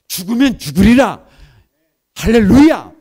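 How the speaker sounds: loud cry of faith, preacher to the congregation; bold shout of faith. Retold or imitated. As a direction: declare it with all your strength. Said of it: "막 해가면서".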